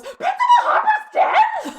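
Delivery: very high, drawn-out mock scream; screaming at full volume. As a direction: shriek the shocking news, hammed-up. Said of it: "screams at the top of his lungs".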